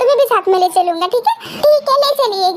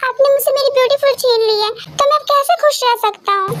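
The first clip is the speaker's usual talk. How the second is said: ordinary talk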